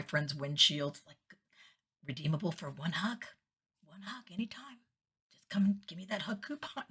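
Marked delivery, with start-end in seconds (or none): 2.1-6.8 hushed, coaxing little invitation; soft hug-coupon offer. Retold or imitated. Friends passing each other a hug coupon, soft and coaxing. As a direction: softly offer a hug, trailing almost to a whisper.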